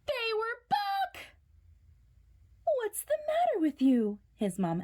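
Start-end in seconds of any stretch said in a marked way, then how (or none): 0.1-1.3 high, slow horrified gasp; shocked cry. A narrator's reading. Jasper finds the underwear back, horrified. 2.8-4.2 worried mom. Acted.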